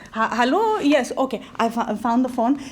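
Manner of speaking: normal talking voice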